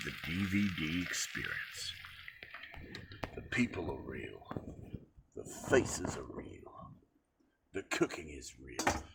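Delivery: hushed, very low whispered tagline. Pretend. Cook pitching his video as an experience, mock-dramatic whisper.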